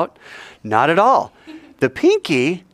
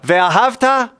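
high, drawn-out ringing call; with gusto; 10 semitones higher, 3 dB louder, slower than usual. Shown as a demonstration. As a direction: lead the congregation in a full-voiced prayer call. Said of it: "say it with some gusto".

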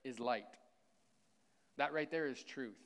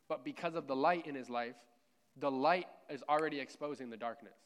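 louder preaching, pushing hard on never; raised pulpit voice, lifting on never; 4 dB louder than usual. Real, not acted. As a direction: preach it at full force to the congregation. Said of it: "Man, I'm really preaching really well".

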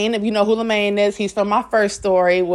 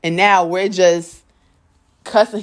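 ordinary talk, nothing marked

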